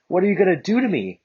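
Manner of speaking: high, nervous question; nervous girl asking Bakugo; scared. Acted. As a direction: ask with rising fear. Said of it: "getting scared".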